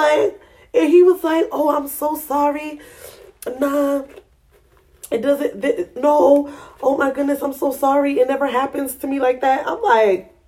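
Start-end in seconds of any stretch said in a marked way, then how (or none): 1.5-9.7 higher, flustered mock apology. Quoting the man apologizing after five pumps, lightly flustered. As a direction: lightly play the embarrassed man.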